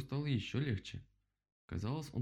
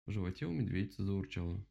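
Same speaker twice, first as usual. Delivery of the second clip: plain reading voice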